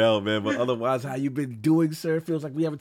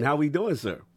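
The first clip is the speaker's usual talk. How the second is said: usual speaking voice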